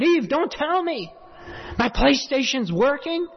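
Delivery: high-pitched retold astonishment; mock disbelief. Preacher retelling his question to the neighbor, incredulous. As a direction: ask it up high, as if amazed.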